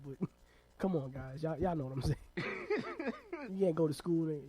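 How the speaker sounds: ordinary talk, nothing marked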